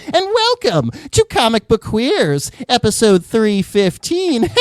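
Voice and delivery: high cackling witch greeting. Acted. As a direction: croon it like a wicked witch. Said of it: "my amazing witch voice".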